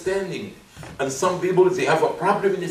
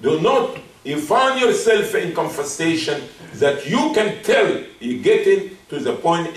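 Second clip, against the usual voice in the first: ordinary delivery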